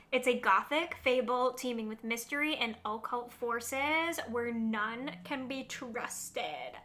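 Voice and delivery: normal talking voice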